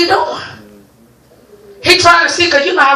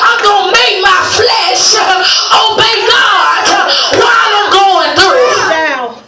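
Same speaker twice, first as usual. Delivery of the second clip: declaration; 4 semitones higher, slower than usual. Acted. Preacher models declaration slowly.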